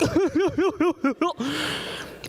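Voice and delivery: high, fluttering hoo-hoo-hoo; imitated hair-groping coo. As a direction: coo in quick high pulses like petting a fluffy animal. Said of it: "they would just come up and be like".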